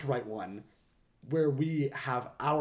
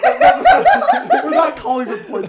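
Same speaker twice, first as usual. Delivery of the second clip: laughing; 13 semitones higher, 18 dB louder, slower than usual. Real, not acted. Laughing at own confusion.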